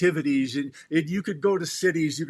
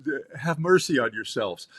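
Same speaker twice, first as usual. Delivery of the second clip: usual speaking voice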